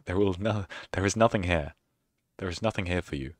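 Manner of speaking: usual speaking voice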